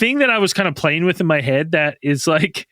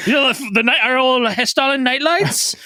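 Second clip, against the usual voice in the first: put-on Norwegian accent; 7 semitones higher than usual. Villager explaining the local lights, Norwegian accent. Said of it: "Keep going with this accent".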